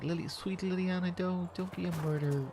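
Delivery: high, coaxing mock plea; sweet pleading. Acts out pleading with Liliana.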